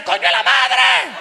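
drawn-out loud curse, slow; hammed-up cry of pain; mock pained yell. Friend cursing at her bleeding ankle, mock pained yell. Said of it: "pasó de "me pica" aquí a".

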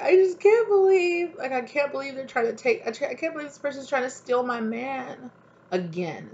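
jilted partner complaining, mock whiny; upset jilted lover. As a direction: whine it like the betrayed girlfriend. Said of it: "they're just like".

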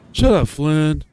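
sharp, annoyed comeback; irritated retort. Acted. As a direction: fire back loudly at the bully. Friend snaps back at the taunt.

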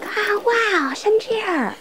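put-on high, breathy-soft childlike wonder; mock awed kid voice. Kids staring at deer in the field, high mock awe. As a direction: play the kids gaping at the deer.

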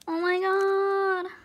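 high, long-drawn moan of disbelief; overwhelmed. Real, not acted. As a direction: stretch it out slowly, truly moved. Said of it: "I feel so bad now".

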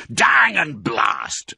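slow, drawn-out frustrated curse; cursing farmer. A narrator's reading. Boggis cursing the missed shot, frustrated farmer. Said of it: "said Boggis".

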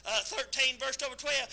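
normal talking voice

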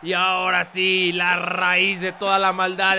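slow, loud la raíz de toda la maldad; dramatic finisher call. Acted.

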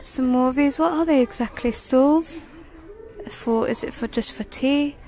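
normal talking voice

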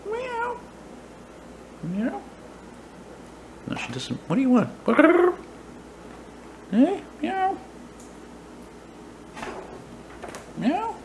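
high, soft imitated cat meow; mock meow. Real, not acted. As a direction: coax the cat with a squeaky meow. Meowing at the cat.

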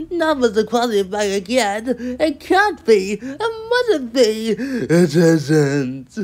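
loud, high, drawn-out wail of disbelief; groaning dismay. A narrator's reading. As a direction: wail it slowly, pleading that it isn't true. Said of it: "he groaned".

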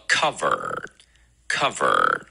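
cover, cover as careful models, R-coloured ending; curled-tongue R. Shown as a demonstration. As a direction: cover with a long curled R at the end. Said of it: "第二个要卷舌的音".

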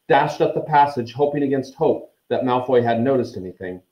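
ordinary delivery

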